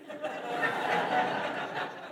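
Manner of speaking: breathy laugh. Real, not acted. Laughs at his own joke.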